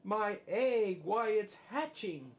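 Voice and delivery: excited elephant shout. A narrator's reading. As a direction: shout it with wonder. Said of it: "shouted Horton".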